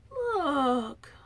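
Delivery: tearful. Real, not acted. Tearing up over gift.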